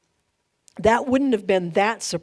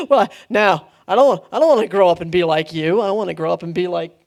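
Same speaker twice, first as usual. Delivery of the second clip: put-on fast, louder, petulant child pushing back; whiny kid refusal; 3 semitones lower, 5 dB louder, faster than usual. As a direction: brush it off like a sulky teenager. Kid refusing the family business.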